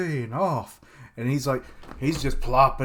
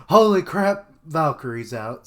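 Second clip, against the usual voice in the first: a loud, higher burst of mock shock; surprised exclamation; 4 semitones higher, 9 dB louder than usual. Vlogger retelling spotting the new chapter, loud surprised blurt. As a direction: blurt it out like you just spotted the news.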